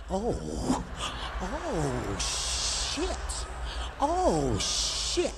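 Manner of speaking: breathy drawn-out oh, whispered shit; mock alarm, hushed and drawn out; mock shock. Man acting out a home robbery, mock shock.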